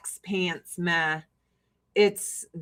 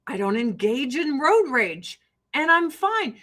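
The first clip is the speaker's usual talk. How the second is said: ordinary delivery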